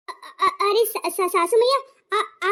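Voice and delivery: stammering, caught off guard by the mother-in-law; startled stammer. Acted. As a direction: trip over the words, flustered. Bride caught idling, stammers. (घबराकर हकलाना)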